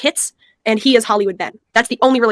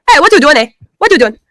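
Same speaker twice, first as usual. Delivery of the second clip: put-on loud Nigerian accent, higher pitch; 6 semitones higher, 6 dB louder than usual. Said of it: "do the African accent".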